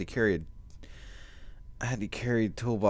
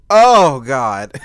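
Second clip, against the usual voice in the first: much higher, much louder sudden yelp; real yelp of pain; pained cry; 6 semitones higher, 19 dB louder than usual. Real, not acted. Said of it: "That hurt a lot".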